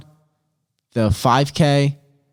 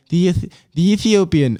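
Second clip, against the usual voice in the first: higher-pitched, bad British accent attempt; British announcer accent; 6 semitones higher than usual. Host imitating race announcers, bad British accent. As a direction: try a British announcer, stumbling at the start. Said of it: "they always say it in, like, a British accent".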